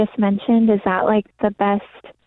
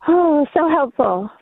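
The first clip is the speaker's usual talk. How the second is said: smiling; 6 semitones higher than usual. Real, not acted. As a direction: let the smile come through. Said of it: "I hear the smile in your voice".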